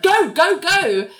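high, punchy mock order; imitated urgent calling; urgent command. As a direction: shoo them out with repeated high bursts. Nell ordering people out.

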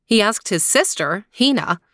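usual speaking voice

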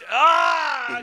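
loud, high cry of surrender; high, loud exasperated wail; exasperated groan. Real, not acted. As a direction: let out a big giving-in "ah". Gives in to badgering about confession.

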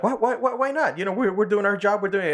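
high, stammered mock defensiveness; defensive protest. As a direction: imitate someone protesting their innocence. Retold protest by the guards.

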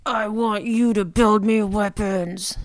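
slow, menacing villain demand; a hint of a cold dragon lord; mean voice. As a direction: lean in slowly and threaten. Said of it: "puts her mean voice back on".

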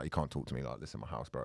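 a low, contained rebuke; quiet firm telling-off. Retold or imitated. Host to the rude plumber, low controlled reprimand.

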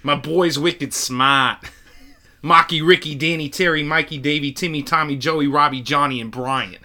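put-on Boston accent, "wicked smart" said like a local. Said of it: "after my go".

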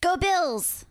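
high-pitched cheer back; playful call-back, pitched well up; answering fan call. Pretend. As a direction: echo the cheer as a passing fan.

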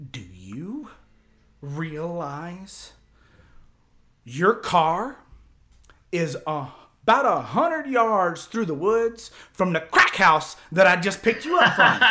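halting, deliberate start building to a high shout; unhappy buddy retold. Buddy discovering the car by the crack house, angry. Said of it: "he ain't none too happy with me".

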